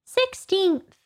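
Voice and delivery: high-pitched little Dormouse; high storybook Dormouse. A narrator's reading. Narrator voices the Dormouse.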